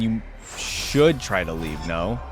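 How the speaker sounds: ordinary talk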